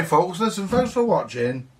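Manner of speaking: ordinary talk, nothing marked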